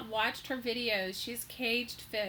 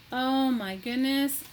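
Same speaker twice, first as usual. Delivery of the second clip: usual speaking voice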